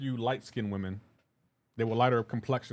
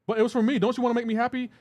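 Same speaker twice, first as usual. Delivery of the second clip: high-pitched mock pleading; pleading girlfriend; 9 semitones higher, 3 dB louder, faster than usual. Streamer mimicking girlfriend in pineapple argument, high hurt pleading. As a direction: imitate a partner guilt-tripping you.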